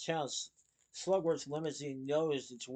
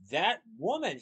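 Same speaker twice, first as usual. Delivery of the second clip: loud, higher furious outburst; angry shout; 4 semitones higher, 6 dB louder than usual. A narrator's reading. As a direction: spit it out in sudden rage. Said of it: "he shouted".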